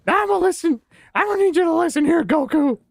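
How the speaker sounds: high, loud, half-laughing rant; [name] as anime villain shouting; Vegeta voice. Pretend. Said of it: "Vegeta voice".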